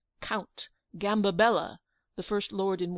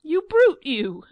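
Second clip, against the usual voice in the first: a far higher, much louder scream that peaks on brute; full scream; 8 semitones higher, 11 dB louder, slower than usual. Acted. Angelica's rage peaking at Giglio, top-of-the-voice scream. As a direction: let it tear out at full pitch. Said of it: "Angelica screamed out".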